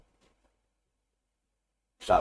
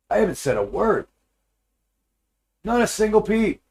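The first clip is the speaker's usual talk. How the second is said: higher, racing worried thoughts; inner panic; 6 semitones higher, 3 dB louder than usual. Retold or imitated. Husband panicking inside over how his wife knew, higher and anxious. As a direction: spiral in alarm.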